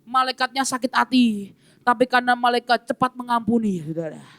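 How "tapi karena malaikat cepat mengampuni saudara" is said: ordinary talk, nothing marked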